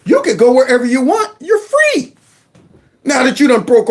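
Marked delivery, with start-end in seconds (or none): 0.1-2.2 high-pitched sarcastic proclamation; mock celebration. Host mocking agents freeing migrants, sarcastic high-pitched cheer. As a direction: sing out freedom with mock glee.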